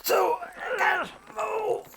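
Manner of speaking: high, slow strained cry; panicked. Acted. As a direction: struggle and cry out, stuck. Trapped by vines.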